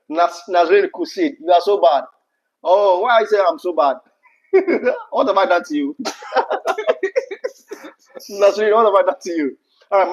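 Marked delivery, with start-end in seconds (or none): none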